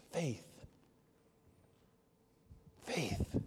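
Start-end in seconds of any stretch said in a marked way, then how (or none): 2.8-3.4 soft whispered words. Real, not acted. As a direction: hush it reverently. Whispered sermon climax.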